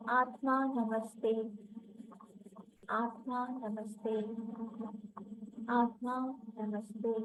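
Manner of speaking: soft, tender repeated greeting; gentle and loving. Shown as a demonstration. Guiding listeners to greet the soul, gently and lovingly. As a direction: say it lovingly, barely above a breath. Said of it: "So gently and lovingly say".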